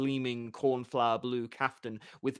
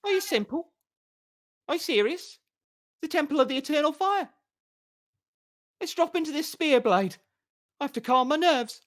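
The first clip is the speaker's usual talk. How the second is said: exasperated; 13 semitones higher, 4 dB louder, faster than usual. Acted. Bard exasperated at Geralt.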